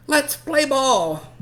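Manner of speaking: put-on high, slow, loud kid's call; eager kid call. Boy telling the others to start the game, high and loud. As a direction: sing it out like a keen boy. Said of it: "he tells the others".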